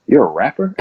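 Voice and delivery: imitating a coach who can't believe it; incredulous question. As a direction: retell his baffled reaction. Coach's retold disbelief at rapper claim.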